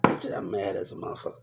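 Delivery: low, hushed mock grumble; retold muted anger. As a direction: imitate being mad under your breath. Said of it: "And I get mad".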